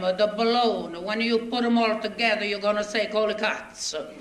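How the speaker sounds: put-on broken English with a heavy Italian accent, comic storyteller; a thick Italian accent, told with a grin; Italian immigrant accent. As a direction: play the bewildered Italian newcomer telling his troubles. Stage comic in Italian immigrant dialect.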